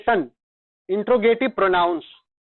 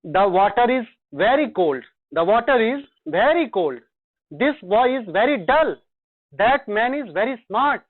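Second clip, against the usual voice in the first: slow dictation; much slower than usual. Shown as a demonstration. Teacher dictates example sentences.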